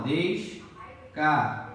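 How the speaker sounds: "descartável" drawn out with gaps between syllables. Shown as a demonstration. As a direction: separate each syllable of "descartável", slowly. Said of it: "Separação". ("descartável" devagar, separando as sílabas)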